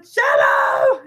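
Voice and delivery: high, excited shout; calling out. Pretend. Streamer imagines going off with the camera, calling out. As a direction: yell the name out playfully.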